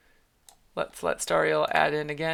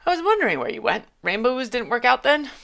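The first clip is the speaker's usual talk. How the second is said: higher, louder, eager questioning; high character voice; 6 semitones higher, 6 dB louder, faster than usual. Acted. A friend asks Veneer where he went in a higher, louder voice. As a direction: ask brightly as an old friend catching up.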